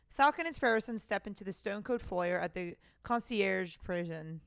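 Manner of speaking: usual speaking voice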